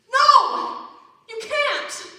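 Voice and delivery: high, loud cry of refusal; emphatic shout. Acted. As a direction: burst out in protest. Passionate rant peaks.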